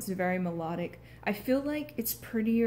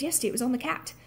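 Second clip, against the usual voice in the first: higher RP mother, short A in "cat"; RP British accent; 5 semitones higher, much faster than usual. Retold or imitated. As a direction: imitate an RP English mother. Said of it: "even in R P, we say cat".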